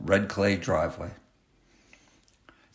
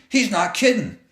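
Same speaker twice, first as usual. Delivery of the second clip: put-on high-pitched boy; 12 semitones higher, 4 dB louder than usual. A narrator's reading. Best friend urges Will.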